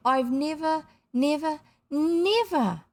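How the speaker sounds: put-on high, emphatic, drawn-out child's gushing; breathless delight. A narrator's reading. Child thrilled after party games, gushing breathlessly. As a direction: stretch each never in delighted excitement. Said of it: "gasped the kid breathlessly".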